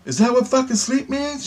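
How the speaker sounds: very high, outraged retold question; exasperated disbelief. Finally learning what sleep means, high exasperated outrage. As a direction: shoot up high in comic outrage.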